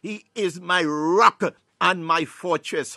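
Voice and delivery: loud, slow declaration of faith; with conviction. Shown as a demonstration. Preacher showing listeners how to pray the psalm, with conviction. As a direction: proclaim it with full confidence. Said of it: "saying this thing with conviction".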